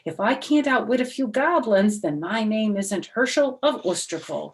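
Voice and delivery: loud, defiant I'm not afraid; bold declaration. Acted. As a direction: stand tall and refuse to be scared. Herschel boasts to rabbi.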